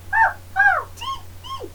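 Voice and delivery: very high squeaky mouse giggle. Shown as a demonstration. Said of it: "our mouse voice".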